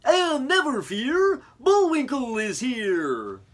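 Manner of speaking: very high, loud, slow hero cry; proud moose announcing himself; heroic Bullwinkle. Acted. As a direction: proclaim it like a cartoon hero. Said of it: "said Bullwinkle".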